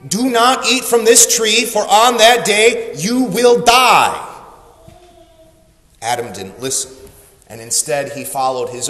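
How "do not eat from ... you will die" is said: higher, emphatic pronouncement, slowing at the end; solemn command. Acted. God giving Adam the rule, stern warning. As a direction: lay down the law, weighting the last words. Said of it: "he gave him the rule".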